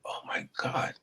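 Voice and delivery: soft stunned whisper; heartbroken whisper. Retold or imitated. Told off by a mother figure, whispered dismay. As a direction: breathe it out, crushed. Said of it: "I was heartbroken".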